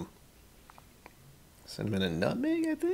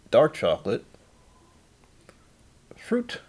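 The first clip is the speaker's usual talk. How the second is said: usual speaking voice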